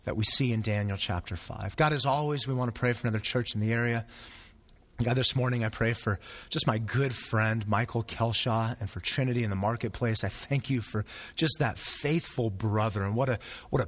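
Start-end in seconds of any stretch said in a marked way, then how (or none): none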